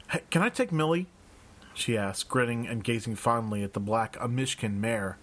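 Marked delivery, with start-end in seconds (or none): 0.1-1.1 put-on eager girl's question, higher, rising; Raven asking. A narrator's reading. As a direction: lift it up like a keen young scout.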